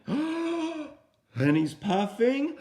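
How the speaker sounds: high sharp gasps for breath; huffing and puffing gasps. Acted. Storyteller acting out the wolf puffing, big gasps. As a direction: gasp in air like the wolf filling his lungs.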